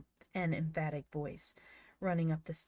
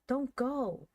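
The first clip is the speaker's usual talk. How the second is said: urgent plea; 8 semitones higher, 4 dB louder than usual. Acted. Van urging Joel.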